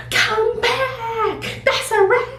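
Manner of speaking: high, frantic yelling after someone; panicked high cries with a sob; screaming. Retold or imitated. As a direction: scream at her to come back. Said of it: "now we really screaming".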